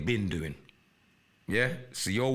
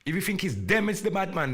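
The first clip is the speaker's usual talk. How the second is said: rising angry shout; 8 semitones higher, 5 dB louder than usual. Real, not acted. Warning rivals who set him up, angry shout. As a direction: voice climbs high and loud in anger.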